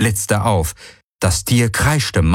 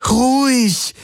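much higher, desperate calming call; urgent plea. Acted. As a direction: plead harder, higher. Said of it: "flehte Kirai ihn an".

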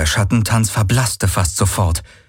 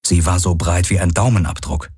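plain reading voice

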